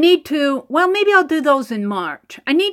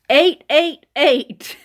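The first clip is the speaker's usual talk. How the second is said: raffle caller; much slower than usual. Real, not acted. Drawing out the winning number.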